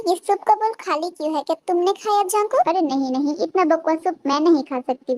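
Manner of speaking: ordinary delivery, nothing marked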